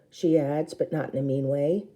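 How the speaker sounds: plain reading voice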